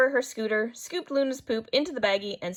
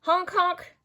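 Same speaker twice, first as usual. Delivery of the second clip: high, loud honk honk like a bus horn; playful bus horn sound; 9 semitones higher, 3 dB louder than usual. Acted. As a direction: toot it out like a horn. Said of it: "tooted the bus".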